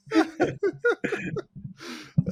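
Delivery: high breathless wheezing laugh; helpless laugh, breath running out; high laughing fit. Real, not acted. Laughing at his friend's joke, helpless high wheeze.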